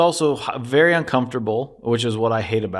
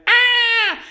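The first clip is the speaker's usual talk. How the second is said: very high excited squeal; much higher, louder happy shriek; delighted squeal; 6 dB louder than usual. Retold or imitated. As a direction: squeal with joy. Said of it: "And she went".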